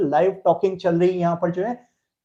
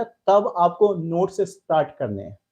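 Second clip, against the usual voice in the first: usual speaking voice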